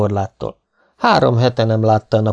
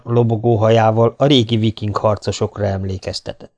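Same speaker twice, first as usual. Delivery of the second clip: ordinary delivery, nothing marked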